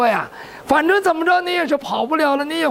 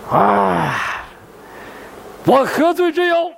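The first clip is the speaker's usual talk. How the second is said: monk feigning innocence; slower than usual. Acted. Accused monk denies guilt.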